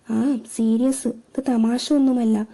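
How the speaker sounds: plain reading voice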